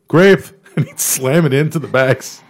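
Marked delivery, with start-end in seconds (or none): 0.1-0.4 high loud shout of one word. Retold or imitated. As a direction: bark it out gleefully. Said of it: "he'd open the bag up and go".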